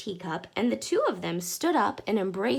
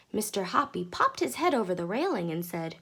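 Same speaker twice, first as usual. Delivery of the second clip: ordinary delivery, nothing marked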